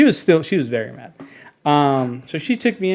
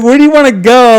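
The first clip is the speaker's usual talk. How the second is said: much louder, higher urgent question; urgent ranger; 9 semitones higher, 12 dB louder, faster than usual. Retold or imitated. Ranger presses the hurt boy, loud and high. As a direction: press the kid hard for an answer.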